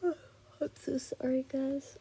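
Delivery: real yawn running into the words; drawn-out, yawny apology. Real, not acted. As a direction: apologize through the tail of a yawn. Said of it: "I hate yawning in these".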